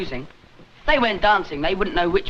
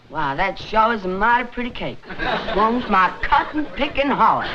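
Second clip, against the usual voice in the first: mock Southern accent. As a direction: play a gushing Southerner for a laugh. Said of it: "let Neville listen to that tape recording".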